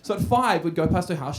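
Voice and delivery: ordinary delivery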